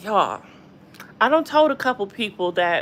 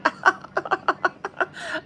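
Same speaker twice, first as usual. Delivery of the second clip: high, breathy giggle; acted-out giggle; 11 semitones higher, 4 dB quieter than usual. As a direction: imitate your own flustered giggling. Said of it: "I'm like".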